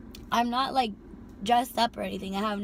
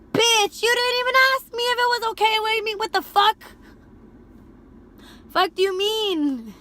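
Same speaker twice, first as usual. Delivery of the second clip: much higher, louder, shouting; furious rant; 11 semitones higher, 8 dB louder than usual. Retold or imitated. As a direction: let it all explode.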